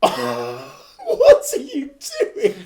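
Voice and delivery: loud, high, laughing exclamation; laughing outrage. Real, not acted. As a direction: shout it in amused disbelief. Co-host disgusted by noise mid-question.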